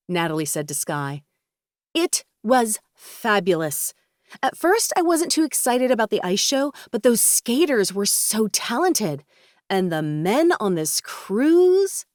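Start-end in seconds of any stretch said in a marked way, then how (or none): 1.9-12.1 flirtatious Sky, gushing. Acted. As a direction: gush theatrically, stretching the key words. Narrator voices flirtatious Sky.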